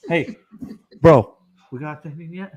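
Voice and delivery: loud pushy friend imitation; imitating Bull. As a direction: call it out like an impatient buddy. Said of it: "This is my Bull eighty impression".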